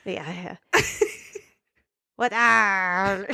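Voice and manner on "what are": Scottish attempt gone pirate; pirate accent. Shown as a demonstration. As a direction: growl the R like a pirate by accident.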